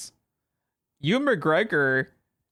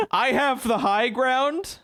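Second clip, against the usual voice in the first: Broadway stage delivery; 6 semitones higher, slower than usual. Retold or imitated. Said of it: "he delivers it like he's on the fucking stage".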